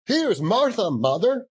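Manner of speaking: put-on high, eager child's voice calling to mother; excited children crying out. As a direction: cry it out like an excited little child. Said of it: "cried the two young Cratchits".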